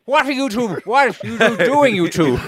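put-on high indignant Dutch accent; demanding, mock Dutch. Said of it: "do the Dutch accent".

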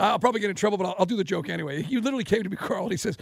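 usual speaking voice

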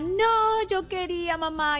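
queja aguda de niño, sin gritar; berrinche infantil imitado. Acted. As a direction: imita a un niño que protesta a su mamá. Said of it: "y entonces se desborde, llore, grite". (pataleta fingida de niño)

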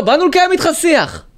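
high-pitched mock yelling; imitated shouting. Mimicking protesters yelling at a man in his car, mock shouting. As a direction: mimic hecklers hollering their slogan. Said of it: "צועקים עליו באוטו".

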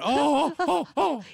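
high, loud horrified gasps; panicked, embarrassed apology. Retold or imitated. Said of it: "And I was, like, horrified".

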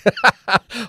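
a high, bubbling laugh that cuts into the story; high burst of laughter. Real, not acted. Host laughing while telling colleagues the car park tale.

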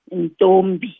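Ntombi said carefully in two syllables; syllable demonstration. Shown as a demonstration. Teacher showing learners the two syllables, Ntombi pronounced carefully. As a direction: sound out Ntombi so both syllables are heard. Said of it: "When I pronounce the name Ntombi".

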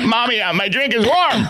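put-on high whiny kid voice; whiny child. Co-host mocking the grown son, high and whiny. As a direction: whine it up like a little boy. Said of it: "He yelled".

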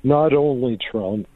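normal talking voice

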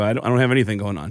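fast, eager blurt; rushed. Retold or imitated. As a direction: hurry it out casually. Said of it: "I go".

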